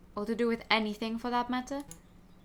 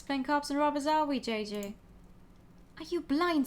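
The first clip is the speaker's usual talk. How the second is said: usual speaking voice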